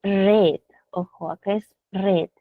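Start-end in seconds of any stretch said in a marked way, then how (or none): 0.0-0.6 "read" with a short e vowel; past-tense pronunciation. Shown as a demonstration. Teacher warning students the past sounds different, short e pronunciation. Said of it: "pero la pronunciación es distinta". 1.9-2.3 "read" with a short e vowel; past-tense pronunciation. Shown as a demonstration. Teacher warning students the past sounds different, short e pronunciation. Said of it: "Acá la pronunciación es".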